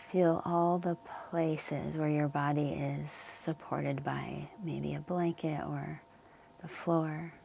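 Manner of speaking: calm Yoga Nidra guidance with long pauses; unhurried, leave space between phrases to settle them in; guided meditation. Real, not acted. Yoga teacher settling listeners into the earth's support, calm guided meditation.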